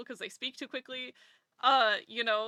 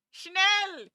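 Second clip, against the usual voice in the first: shouted German command; 9 semitones higher, 9 dB louder than usual. Pretend. Showing off German words to chat, loud Schnell. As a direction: snap it out high and loud. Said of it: "German words shouted".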